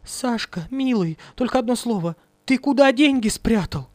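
high pleading wail to a dead man; high widow's wail; wailing plea. Acted. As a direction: beg him, voice high and desperate. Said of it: "бесстрашно вопить".

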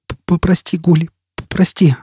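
high, stammering plea for forgiveness; choked apology. Acted. Jisbella apologises to Foyle after hysterics, choked stammer. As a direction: stumble on the first word, still overcome. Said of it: "подавившись всхлипываниями".

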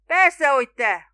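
booming shout. A narrator's reading. Spiro answering the doubting boatman, booming. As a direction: boom each word out, exasperated.

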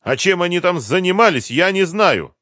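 high, loud, defensive excuses; cornered captive. A narrator's reading. Driver minimises his part to interrogators, defensive. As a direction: protest that you were never trusted.

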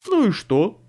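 jumpy man objecting. A narrator's reading. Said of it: "возразил второй голос".

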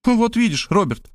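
lightly voiced woman gloating, pitch up; triumphant. A narrator's reading. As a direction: say it like a point just won. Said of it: "торжествовала Мария".

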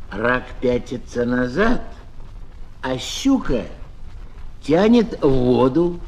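ordinary delivery, nothing marked

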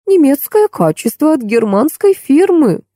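high, slow admiring remark; approving. A narrator's reading. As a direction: draw it out with approval. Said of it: "одобрительно заметила няня". (одобрительно, протяжно)

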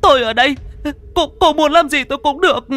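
mother begging the ghost in a high frightened voice; trembling plea. Acted. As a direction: beg the ghost for your child. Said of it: "run rẩy van xin".

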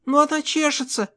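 put-on high child voice, complaining; маленькая дочь. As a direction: whine to daddy in a little girl's high voice. Дочь жалуется папе на зуд. (жалобный высокий детский голосок)

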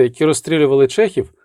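usual speaking voice

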